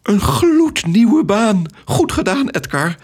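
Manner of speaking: an overjoyed friend squealing congratulations; squealing with excitement. Acted. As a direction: shriek with joy at his new job. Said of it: "gilde van opwinding".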